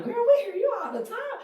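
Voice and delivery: put-on high, loud excited girlfriend voice; fans quoted. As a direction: squeal it like a thrilled fan. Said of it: "They would tell me all the time".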